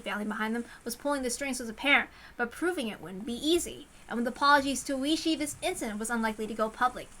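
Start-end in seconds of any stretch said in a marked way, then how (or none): none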